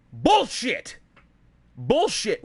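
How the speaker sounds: explosive, emphatic rebuttal; angry outburst. Real, not acted. Streamer rejecting a viewer's claim about Rockstar, angry. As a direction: throw it out as a real angry dismissal.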